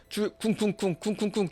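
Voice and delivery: spoken door-pounding noise; imitated knocking. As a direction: chant thuds in even, repeated beats. (문 두드리는 소리 흉내)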